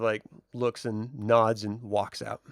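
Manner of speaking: usual speaking voice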